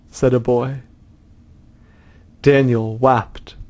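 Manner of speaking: plain reading voice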